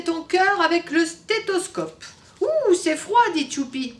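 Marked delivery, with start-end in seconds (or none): 2.4-3.2 high surprised squeal; a child jumping at a cold stethoscope; startled little boy. Acted. Tchoupi startled by the cold stethoscope, squealing.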